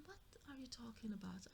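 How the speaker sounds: a shocked, breathless whisper; stunned whisper. Retold or imitated. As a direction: whisper it, stunned at what she hears. Wife stunned by husband's news.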